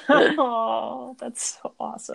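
real delighted laugh into Oh. Real, not acted. Laughs at the Diet Coke story.

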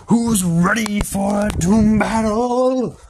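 loud, high, slow hype announcer; booming fighting game announcer. Acted. Host hyping the fight.

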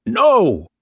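high, loud defiant shout. Acted. Captain forbidding men to leave, loud high shout. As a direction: bellow it to stop them. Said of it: "Bligh shouted".

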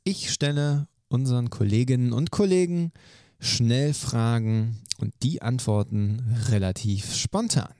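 normal talking voice

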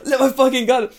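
high hammed-up crying lament; mock wail. Teacher mock-laments letting his guard down. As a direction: whimper it with comic self-pity.

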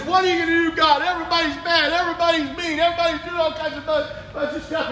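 loud, high complaint to God; exasperated prophet. Retold or imitated. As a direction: demand an answer from God. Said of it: "He says".

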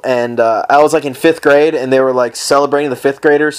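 ordinary delivery

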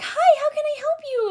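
very high, sing-song customer-service greeting; sugary server voice. Retold or imitated. Server suddenly sweet to the gentleman, high and sugary. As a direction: gush it like a fake-sweet server.

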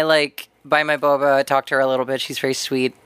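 ordinary delivery

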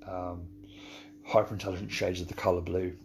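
ordinary talk, nothing marked

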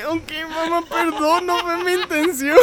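put-on high, slow, sheepish kid voice; hammed-up meek apology; mock childish apology. Retold apology to mom.

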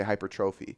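hypertrophy said hyper-TROH-fee; others' pronunciation. Shown as a demonstration. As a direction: stress a long TROH in hypertrophy. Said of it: "some people say".

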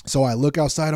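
usual speaking voice

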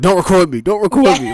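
loud, high hammed-up killer; mock killer demand. Killer to the news crew, loud mock demand. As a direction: bark at the camera.

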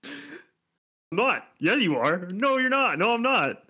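high-pitched back-and-forth squabble; mock bickering. Co-host acting out a pissy exchange, mock bickering. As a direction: act out a petty argument in an exaggerated high voice.

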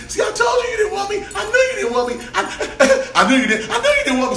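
very high-pitched, hammed-up tearful wail; mock crying. Date accusing him of rejecting her, mock crying. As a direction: wail it in a squeaky imitation of a crying woman. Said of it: "And she starts crying, and she's like".

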